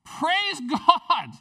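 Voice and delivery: loud, high cry of praise; exultant shout. Real, not acted. Preacher to congregation, exultant shout of praise. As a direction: burst out with joy. Preacher exclaims mid-sermon.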